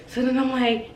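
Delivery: ordinary talk, nothing marked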